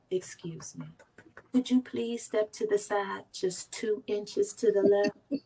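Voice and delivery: soft, slow, timid asking; mock-meek plea, far too polite; overly polite request. Shown as a demonstration. Asking the mountain meekly to step aside, softly. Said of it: "why am I looking at the mountain going".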